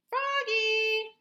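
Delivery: loud, high call across the house. A narrator's reading. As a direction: call the boy from another room. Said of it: "called his dad".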